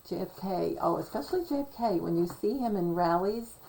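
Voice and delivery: usual speaking voice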